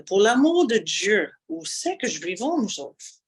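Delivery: exaggerated Acadian French plea; Acadian accent. Shown as a demonstration. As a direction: cry out in broad Acadian accent. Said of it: "I'm gonna exaggerate a little bit today".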